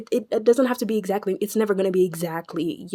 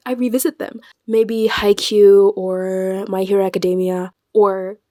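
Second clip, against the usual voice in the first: ordinary delivery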